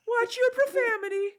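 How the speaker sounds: high, mock prissy rebuke; high mock-stern telling-off; prim scolding voice. Quoting a meme line.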